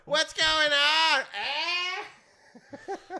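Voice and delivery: mock confused old player on court; confused old guy. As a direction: sound lost and doddering. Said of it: "another guy's going".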